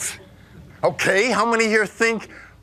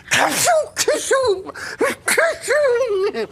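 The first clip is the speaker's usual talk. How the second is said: high sneezing cashew; 14 semitones higher, slower than usual. Acted. Wolf playing a cashew tree, high sneezing cashew.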